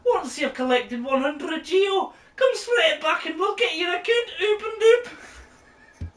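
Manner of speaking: high, giggly shopkeeper patter. Acted. Greeting the player as the game's shopkeeper, high and laughing. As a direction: play the fussy banker who can't keep a straight face. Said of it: "you were the shopkeeper".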